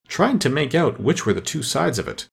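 ordinary delivery, nothing marked

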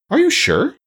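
high-pitched startled disbelief. A narrator's reading. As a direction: ask it taken aback, voice leaping up. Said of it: "with a startled look".

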